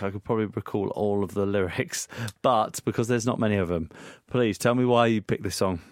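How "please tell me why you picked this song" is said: ordinary delivery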